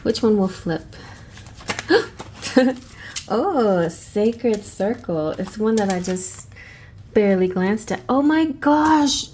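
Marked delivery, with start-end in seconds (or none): 1.7-2.8 surprised gasp. Real, not acted. Seeing the card flip. 8.1-9.3 high, drawn-out amazement; delighted gasp. Real, not acted. Reacting to the drawn card.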